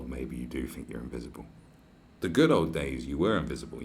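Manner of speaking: ordinary talk, nothing marked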